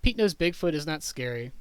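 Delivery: usual speaking voice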